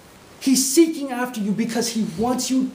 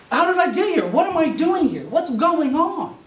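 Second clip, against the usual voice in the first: confused; 9 semitones higher, 4 dB louder than usual. Pretend. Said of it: "And you're like".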